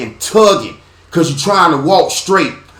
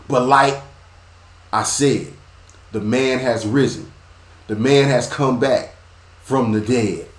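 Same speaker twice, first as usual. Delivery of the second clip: ordinary talk, nothing marked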